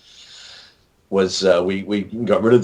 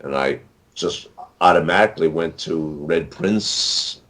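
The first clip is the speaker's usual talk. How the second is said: ordinary talk, nothing marked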